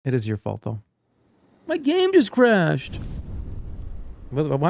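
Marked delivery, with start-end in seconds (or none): 1.7-3.0 slow, higher hyped YouTuber voice. Pretend. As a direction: stretch it out like a YouTuber video. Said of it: "the YouTuber voice today".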